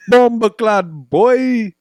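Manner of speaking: exaggerated fake Jamaican voice; mock Jamaican accent. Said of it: "making fun of that accent".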